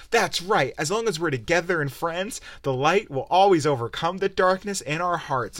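a hint of syrupy cartoon sincerity; mock earnest Sora. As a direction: parody a cheesy friendship speech. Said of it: "and then Sora's like".

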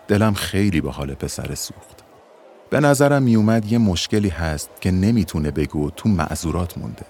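ordinary delivery

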